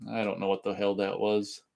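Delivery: usual speaking voice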